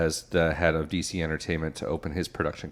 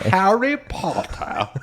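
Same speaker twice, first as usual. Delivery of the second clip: mock English accent; 11 semitones higher, much slower than usual. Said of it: "real bad English accent".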